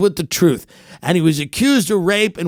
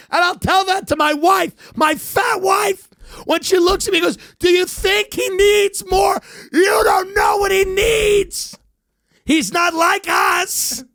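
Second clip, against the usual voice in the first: hammed-up shouting; 12 semitones higher than usual. Obsessed fan yelling at his wife about the podcaster, hammed-up shout. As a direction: yell it like an unhinged superfan.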